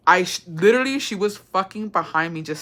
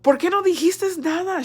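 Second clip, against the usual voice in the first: shocked; 11 semitones higher than usual. Retold or imitated. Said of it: "I was like".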